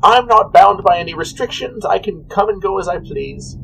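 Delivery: high-pitched, sing-song character voice; high, airy shopkeeper; cheery potion seller. Acted. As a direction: pitch it up like an obliging little merchant.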